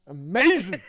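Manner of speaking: mock awe. Real, not acted. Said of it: "It's amazing".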